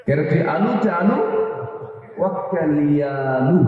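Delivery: slow, drawn-out ওয়াক্কালি আলু; slow repeat. Shown as a demonstration. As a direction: slowly spell out the dialect line. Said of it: "কি বুঝতেছেন কি?".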